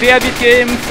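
usual speaking voice

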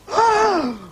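high, sharp gasp; shocked gasp. Acted. Officer seeing the shoes, shocked gasp. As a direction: gasp in horror.

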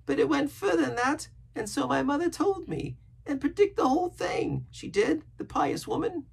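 very high, earnest sailor voice; pious rambling castaway. A narrator's reading. Castaway telling Jim of his pious youth, earnest.

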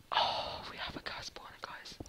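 barely audible, breath-only delight; hushed disbelief; awed whisper. Real, not acted. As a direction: whisper the news like a precious secret. Awed repeat of the big news.

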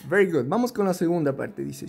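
ordinary delivery

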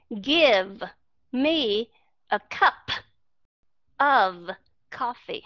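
each word isolated with a pause after it; slow, over-separated demonstration. Shown as a demonstration. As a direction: break the sentence into separate pieces. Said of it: "Mà họ không nói là".

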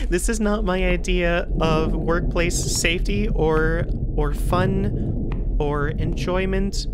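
slowed, deliberate grumble; slow complaint. Real, not acted. Gamer slowly grumbling that this is no fun. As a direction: spell out each word slowly and pointedly.

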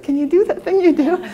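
imitating a nervous sister, pitched high; lightly mock a nervous, hopeful request. Sister asking for the dishwasher trick, mock nervous. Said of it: "she's looking a little bit nervous".

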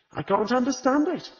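light, high teasing tone, not quite serious; half joking. A narrator's reading. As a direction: Agatha half jokes to Mrs Bloxby, high and light. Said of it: "half joking".

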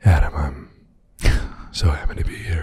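low, breathy Harrison Ford voice; Harrison Ford impression. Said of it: "Harrison Ford?".

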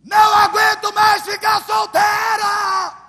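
high, slow wailing shout; desperate cry to God. Retold or imitated. Preacher voicing a single woman crying out to God, loud wail.

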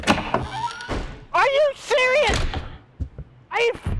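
high drawn-out disbelief; stretched disbelieving squeal. Real, not acted. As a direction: wail it in disbelief. Same hallway again.

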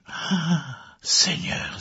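high, airy gasp of shock; shocked exclamation. A narrator's reading. Richard sees the shimmering figure in the clearing, shocked exclamation. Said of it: "s'exclama Richard".

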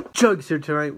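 shouted hype opener, energetic; loud hype intro. Real, not acted. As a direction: open the video with a loud shout. Hyped intro to chug video.

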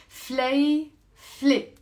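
فليفلة said slowly and clearly, fle-fle; careful pronunciation. Shown as a demonstration. Teacher showing learners how to say it, slow and clear. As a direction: spell out فليفلة slowly in two syllables. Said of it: "Two syllables".

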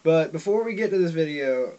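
usual speaking voice

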